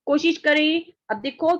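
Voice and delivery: normal talking voice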